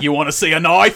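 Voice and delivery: hammed-up Aussie tough guy; mock Australian accent. Mock Crocodile Dundee quote.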